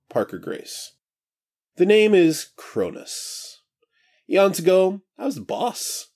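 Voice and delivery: high, breezy, carefree god; casual new voice. Acted. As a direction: stretch and chat like just woken. Cronus reveals plans.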